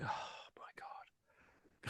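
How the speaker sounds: whispered, drawn-out sigh of despair; exasperated whisper. Retold or imitated. Dad recalling a struggle to get child out of van, exasperated whisper. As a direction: breathe it out, very softly and slowly.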